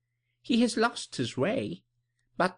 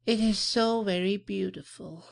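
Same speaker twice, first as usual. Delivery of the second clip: wistful; 13 semitones higher than usual. A narrator's reading. Blind lady wistfully admiring her garden to the visitor. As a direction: sigh it out with longing. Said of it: "she said wistfully".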